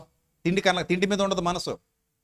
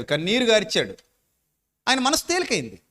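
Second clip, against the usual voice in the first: usual speaking voice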